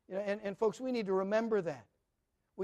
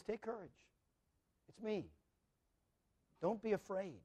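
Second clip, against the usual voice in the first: softer, hushed and calming; gentle reassurance; 8 dB quieter than usual. Acted. Jesus calming his frightened disciples, soft reassurance. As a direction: soothe the frightened men, quietly.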